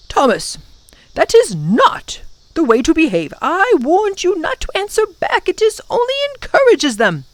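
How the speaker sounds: understated scolding, climbing high; stern professor. A narrator's reading. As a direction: reprimand a child firmly, pitch rising. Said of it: "The professor spoke sternly".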